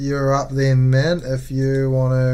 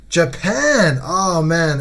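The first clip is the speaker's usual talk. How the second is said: bright, high excited cry; light up at the name; 6 semitones higher, 7 dB louder, much slower than usual. Real, not acted. Delighted at opponent's country.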